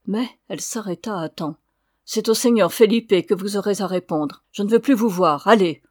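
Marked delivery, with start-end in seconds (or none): 2.1-5.5 de plus en plus fort, furieuse; chasse-le avec colère; colère montante. Acted. La señora furieuse chasse Alessandro. 5.5-5.8 plus fort, ordre sec; chasse-le. Acted. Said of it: "en répétant".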